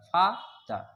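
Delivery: "father" broken as fa, pause, ther; split into syllables. Shown as a demonstration. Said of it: "फा पे रुकना पड़ता है".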